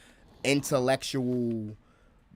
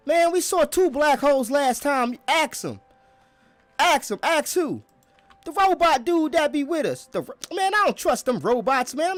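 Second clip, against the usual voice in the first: higher and louder, insisting hard; heated future argument; 12 semitones higher, 6 dB louder than usual. Acted. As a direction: yell it at a doubting friend. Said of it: "That's the conversation gonna be like".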